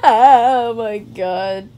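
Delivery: slow, loud, drawn-out sobbing; tearful wailing. Pretend. Gamer at the end of the series, tearful wailing. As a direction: wail it out, overcome by the ending.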